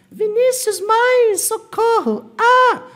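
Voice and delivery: very high, loud squealing cry for help; comic falsetto scream of alarm; mock panic. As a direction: shriek like a hammed-up panicking student. Voices a panicking confused student.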